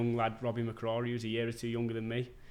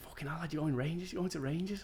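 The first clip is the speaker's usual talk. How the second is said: mates' disbelief; 7 semitones higher than usual. Retold or imitated. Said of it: "them two were like".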